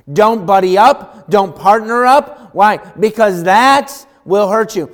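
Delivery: loud, driving preacher's voice; fiery preaching. Acted. Preacher warning the congregation, loud and fervent. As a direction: preach it hard, pressing each warning.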